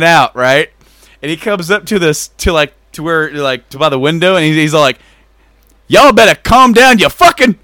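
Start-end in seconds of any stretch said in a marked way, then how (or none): 5.9-7.6 louder, higher threatening yell; mock yell. Angry neighbor at the window, loud mock threat.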